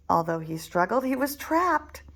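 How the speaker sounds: ordinary delivery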